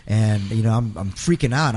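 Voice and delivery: ordinary talk, nothing marked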